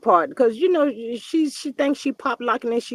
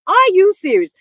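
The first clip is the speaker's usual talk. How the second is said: disbelieving; 7 semitones higher, 8 dB louder than usual. Real, not acted. Mocking reaction to the wig.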